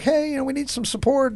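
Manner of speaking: high-pitched mock appeal for help; imitated plea for backup. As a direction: raise the voice high as the police asking for backup. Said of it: "and be like".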